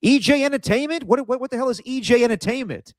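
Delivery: high, exasperated mock outburst as the dad; incredulous movie dad. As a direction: squeak out the disbelief in character. Quoting the movie dad scene.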